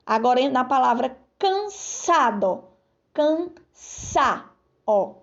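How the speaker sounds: ordinary talk, nothing marked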